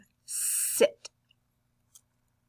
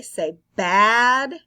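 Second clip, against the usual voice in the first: bad said loud and clear; teacher stressing bad; stressed bad; 9 dB louder than usual. Shown as a demonstration. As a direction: hit bad louder so they hear the B. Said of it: "tell me the word that starts with B".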